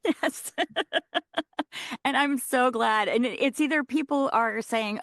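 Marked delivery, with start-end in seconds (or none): 0.6-2.0 bright high laugh; happy laugh. Real, not acted. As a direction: burst out laughing. Delighted at the question.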